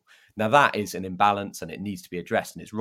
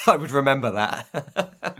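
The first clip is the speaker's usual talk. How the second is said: laughing; 6 semitones higher, 5 dB louder, slower than usual. Real, not acted. Laughing at raccoon falling.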